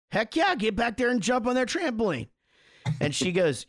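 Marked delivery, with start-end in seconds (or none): 0.1-2.3 pitched high and eager; keen dad urging his son to go jump; retold enthusiastic go-ahead. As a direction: cheerfully wave the kid off to the trampoline. His own retold enthusiastic reply.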